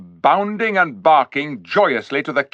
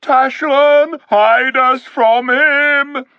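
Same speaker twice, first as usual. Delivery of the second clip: much higher, louder, slow wailing plea; terrified cry; 8 dB louder, much slower than usual. A narrator's reading. Frightened beasts crying out to Tashlan, wailing. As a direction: howl it in terror. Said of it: "moaned and howled and called out".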